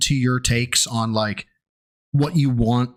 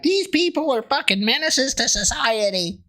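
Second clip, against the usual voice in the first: pitched way up, scandalized; outraged conservative voice; 9 semitones higher than usual. Retold or imitated. Mocking the right-wing outrage at Salt-N-Pepa, pitched up and scandalized. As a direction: play a pearl-clutching pundit. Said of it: "you would be like".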